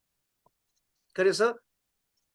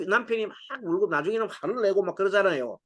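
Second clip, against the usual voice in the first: ordinary delivery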